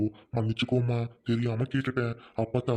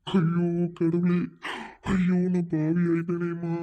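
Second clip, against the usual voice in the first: anguished lament; 5 semitones higher, slower than usual. Acted. Uncle grieving girl's story.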